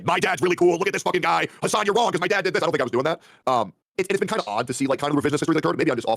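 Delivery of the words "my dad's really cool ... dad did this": high, proud imitation of himself bragging; lightly voiced show-off; mock bragging about dad; mock boastful son. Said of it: "bring him up and say".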